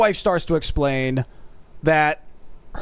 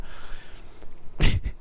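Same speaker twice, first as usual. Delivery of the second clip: quiet breathy laugh; stifled chuckle; 6 dB quieter than usual. Real, not acted. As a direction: crack up mid-story. Cracks up telling story.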